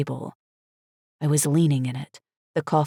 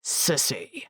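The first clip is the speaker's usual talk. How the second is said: hiss; 3 semitones lower, 5 dB quieter than usual. A narrator's reading. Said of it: "he hissed".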